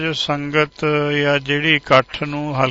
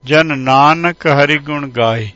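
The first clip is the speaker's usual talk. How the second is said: slow, drawn-out and louder recitation of the verse; scripture recitation; 4 dB louder, much slower than usual. Acted. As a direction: intone the scripture line by line, unhurried. (ਹੌਲੀ ਤੇ ਉੱਚੀ ਗੁਰਬਾਣੀ ਪਾਠ)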